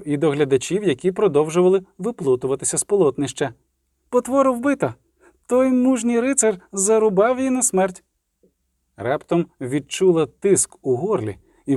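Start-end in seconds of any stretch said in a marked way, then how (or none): 4.1-8.0 high-pitched proclamation to a crowd; crying out. Acted. Ciri to fleeing crowd and onlookers, crying out. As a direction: announce it high, as if calling over heads. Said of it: "крикнула до натовпу".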